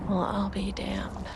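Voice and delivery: soft, slow, amazed whisper; whispered surprise. Acted. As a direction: breathe it out in disbelief. Astonished whisper.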